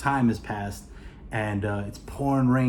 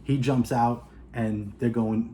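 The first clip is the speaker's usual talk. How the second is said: ordinary delivery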